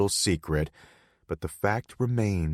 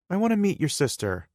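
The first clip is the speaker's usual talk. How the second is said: put-on higher pitched playful girlfriend; young woman voice; 12 semitones higher, 4 dB louder, faster than usual. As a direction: voice her higher, coaxing.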